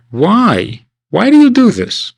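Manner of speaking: mock hurt child; child asking father. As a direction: beg your father to explain. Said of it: "you come to him and you say".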